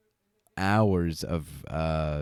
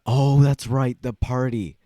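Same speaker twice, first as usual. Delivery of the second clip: sudden realization; 6 semitones higher, 6 dB louder than usual. Real, not acted. Sudden remembering.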